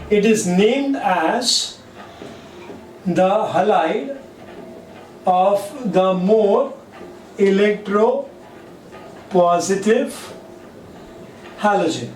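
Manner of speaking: slow, spaced-out dictation with long pauses; teacher dictating notes word by word. Shown as a demonstration. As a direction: spell it out slowly so students can write. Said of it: "teacher dictating a definition".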